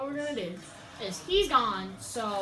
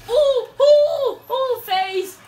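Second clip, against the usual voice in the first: excited squeal; 12 semitones higher, 15 dB louder, much slower than usual. Real, not acted. Excited gaming callout.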